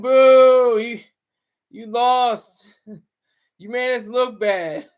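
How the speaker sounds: loud, drawn-out jeer with laughter; half-laughing mock heckle; mock crowd booing. Imitates a crowd booing [name].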